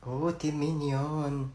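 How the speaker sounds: low, soft and very slow; tender cooing. Acted. Cooing to a supposed sweetheart, slow tender voice. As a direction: draw it out affectionately. Cooing overheard through a door.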